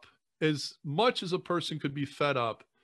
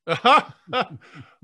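loud burst of real laughter; laughing; 5 semitones higher, 10 dB louder than usual. Real, not acted. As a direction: laugh out loud, caught off guard.

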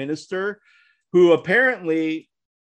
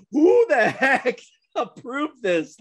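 incredulous, through laughter; slow, laughing disbelief; 7 semitones higher, slower than usual. Retold or imitated. Incredulous at the tattooed minister.

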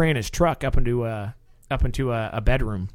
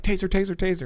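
urgent taser call; 6 semitones higher than usual. Retold or imitated. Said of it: "So you're like".

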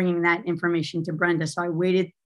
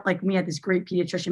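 ordinary talk, nothing marked

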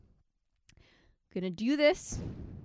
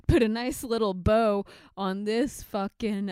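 ordinary delivery

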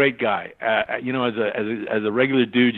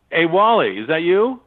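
higher, louder call to someone downstairs; 6 semitones higher, 4 dB louder than usual. Retold or imitated. Actor hears the door downstairs and calls down to director. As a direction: holler it down the stairwell.

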